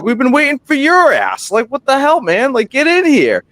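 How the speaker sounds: high, loud mock scolding; teasing yell. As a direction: call him out like a buddy who kept you waiting. Friends yelling at late friend.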